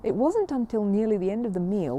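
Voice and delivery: usual speaking voice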